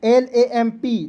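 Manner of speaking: एल ए एम पी spelled slowly, one by one; slow classroom spelling drill; slow spelled letters. Shown as a demonstration. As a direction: spell एल ए एम पी with clear pauses. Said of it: "उच्चारण आई के रूप में".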